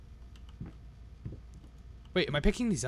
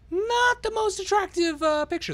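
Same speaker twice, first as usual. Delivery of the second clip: 1 mock high drawn-out voice; 5 dB louder, slower than usual. Mocking an ugly picture.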